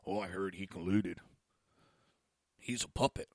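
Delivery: low, soft gossip imitation; mock accuser. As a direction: pass it on as a feeble rumour.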